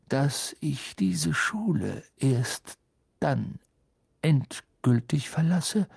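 very deliberate, with weighty pauses; slow and distinct. A narrator's reading. Headmaster stating when he will truly leave, slow and distinct. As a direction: spell out each phrase so it lands. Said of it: "sehr langsam und deutlich sprechend".

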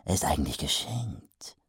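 aside. Acted. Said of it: "fügte er hinzu".

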